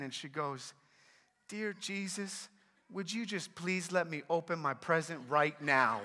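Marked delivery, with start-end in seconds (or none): 1.5-6.0 imitated little girl, starting very soft; small child's pleading prayer; praying child. Daughter praying to open her present, soft imitated child voice. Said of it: "hear our daughter pray again".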